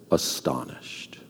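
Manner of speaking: whispered astonishment, very slow; hushed awe. A narrator's reading. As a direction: breathe the word in awe. Narrating the believers' shock.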